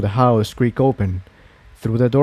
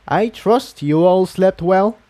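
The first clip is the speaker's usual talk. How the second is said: Quill Dipper voice; 7 semitones higher than usual. A narrator's reading.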